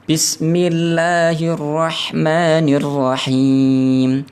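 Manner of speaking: clear Quran recitation. Shown as a demonstration. Teacher demonstrating clear recitation of the basmala to students, slow chanted. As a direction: chant each letter clearly and slowly. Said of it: "haknya dibaca jelas ya".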